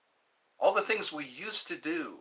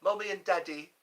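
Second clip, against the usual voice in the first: higher-pitched put-on British; British accent; 4 semitones higher, faster than usual. Streamer trying out what kids called their parents, British accent. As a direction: play at being British. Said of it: "That sounds very British".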